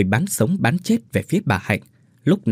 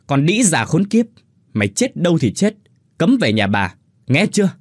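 higher and louder, furious roar; roaring abuse; 6 semitones higher, 3 dB louder than usual. Acted. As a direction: bellow her out of the house. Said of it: "chống nạnh chỉ tay gầm lên". (gầm lên giận dữ)

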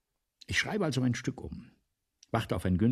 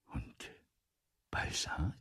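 whisper; 7 semitones lower, 7 dB quieter, slower than usual. Acted. Writer asks about himself.

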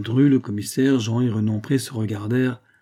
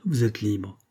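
a slight quaver of emotion; trembling voice; slower than usual. A narrator's reading. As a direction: commissioner moved, trembling voice. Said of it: "d'une voix tremblante".

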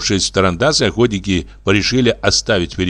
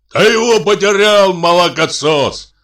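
high, loud angry bellow; thunderous general; 9 semitones higher, 4 dB louder than usual. A narrator's reading. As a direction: roar at a blundering youngster, drawing out the insult. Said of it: "раздался громовой голос генерала". (громовой, гневный крик генерала)

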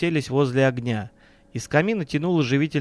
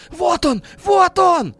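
very high panicked shriek, growing louder; terrified squeal; 6 dB louder, much slower than usual. A narrator's reading. Businessman points at the door, shrieking in terror. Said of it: "вдруг завизжал Леонид Владимирович".

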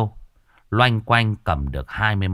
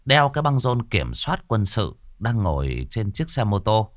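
ordinary delivery

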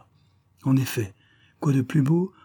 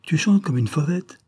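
bright exclamation, pitched higher; exclaiming; 6 semitones higher, 3 dB louder than usual. A narrator's reading. Floch exclaiming admiringly to Aliette. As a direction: burst out in delighted praise. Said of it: "exclama-t-il".

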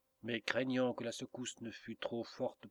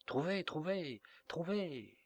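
put-on confused old echo; 7 semitones higher than usual. A narrator's reading. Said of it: "répéta le vieux grand-père sans savoir".

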